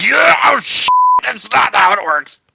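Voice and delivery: high, loud startled protest; loud outburst. Real, not acted. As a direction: burst out in protest. Sudden outburst at screen.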